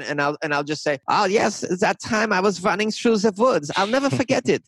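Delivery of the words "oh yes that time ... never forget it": hammed-up German accent, pitched higher. Shown as a demonstration. Podcaster demoing his quote voices for listeners, hammed-up German accent. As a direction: play a German witness with a mock German accent, voice up high. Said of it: "they might quote somebody with a German accent".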